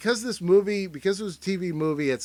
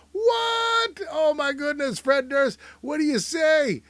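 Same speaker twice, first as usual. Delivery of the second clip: shouted mock Durst; 11 semitones higher, slower than usual. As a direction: yell it like a starstruck dude. Voicing [name] in the photo.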